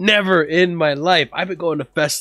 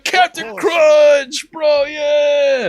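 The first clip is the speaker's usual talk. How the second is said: high, slow, loud chant of the name; rowdy yelling; 12 semitones higher, 6 dB louder, much slower than usual. Retold or imitated. Crew shouting while dumping cereal, high slow yell. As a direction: bellow it like a party chant. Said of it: "They were just".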